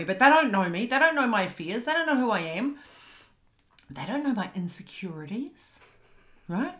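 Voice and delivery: usual speaking voice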